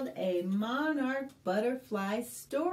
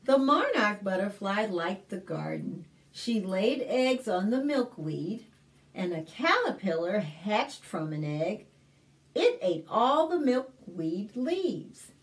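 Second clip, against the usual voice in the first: put-on clear, lively read-aloud for little kids; story narration; 3 semitones lower, 4 dB louder than usual. Librarian reading a picture book to kids, lively storytelling.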